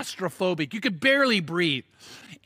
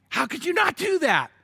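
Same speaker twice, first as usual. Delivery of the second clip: high, stunned question; shocked; 8 semitones higher than usual. Retold or imitated. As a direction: voice the sailors' disbelief. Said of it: "They're, like, shocked".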